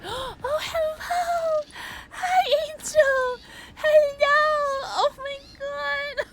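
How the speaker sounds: high, crying-edged greeting, very stretched; emotional squeal. Real, not acted. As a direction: near tears of joy, calling out hello. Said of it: "I'm so emotional".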